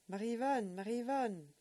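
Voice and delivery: loud, high calling shout; shouting a name. Acted. Boy running down the corridor, shouting for Marie-Yvonne. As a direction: call her from down the corridor.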